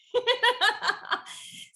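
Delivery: high, hearty laugh; real laugh. Real, not acted. Runner laughing after quoting her husband, amused. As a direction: laugh out freely.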